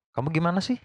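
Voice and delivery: "Kamu gimana sih?" asked gently, only a mild lift on sih; just asking, no shouting; plain curious question without anger; calm question, not angry. Shown as a demonstration. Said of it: "Tapi nggak marah, nggak teriak-teriak".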